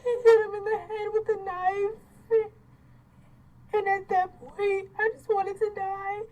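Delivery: real tearful outpouring, voice pitched high and loud; crying. Real, not acted. Recounting the attack on her brother, crying. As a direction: sob through the words, loud and high.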